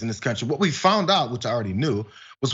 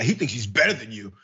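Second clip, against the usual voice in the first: lower, scoffing put-on voice; mock conservative sneer; 5 semitones lower than usual. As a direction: sneer it like a resentful pundit. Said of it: "Barack Obama was a smart guy".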